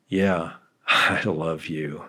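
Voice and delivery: slow, soft, grudging I love you; reluctant, half-laughing yeah. Shown as a demonstration. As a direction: drag it out like you barely mean it. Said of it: "the way you say what you say".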